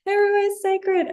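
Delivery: high, fast, giddy gush of wonder; overwhelmed excitement. Retold or imitated. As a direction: squeal it like a kid seeing everything at once. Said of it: "You're like".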